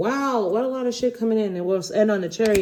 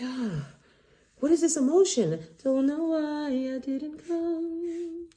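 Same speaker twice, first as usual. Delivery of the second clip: mock confused admirer; 8 semitones higher, 4 dB quieter, much slower than usual. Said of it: "They're like".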